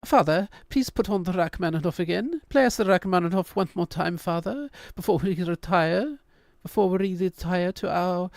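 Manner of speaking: put-on high, prim British child voice; a hammed-up British kid asking politely; posh British child. Kids begging father in mock British accent. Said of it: "they're British kids now".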